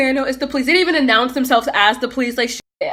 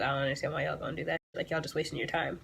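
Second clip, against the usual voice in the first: quiet fast mutter; 7 semitones lower, 15 dB quieter, faster than usual. Retold or imitated. Retelling reply to police.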